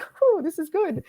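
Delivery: high, slow sigh of relief; retold relief. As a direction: act out thinking you're safe. Thought she was safely through.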